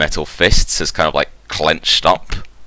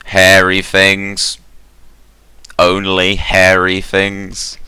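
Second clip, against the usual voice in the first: very slow, level robot answer; drawn-out, mechanical syllables; monotone robot voice; 3 semitones higher, 4 dB louder, slower than usual. Acted. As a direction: intone each word like a machine. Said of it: "monotone robot voice".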